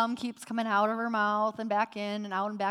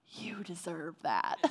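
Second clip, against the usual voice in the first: dry aside; 6 semitones lower, 7 dB quieter than usual. Retold or imitated. Husband's dry aside in car.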